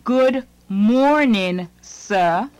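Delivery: ordinary talk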